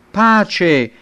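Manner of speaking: high, loud cry to the king; shouted greeting. A narrator's reading. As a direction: call it out as a messenger's hail. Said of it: "Achimaaz gridò al re". (saluto gridato al re)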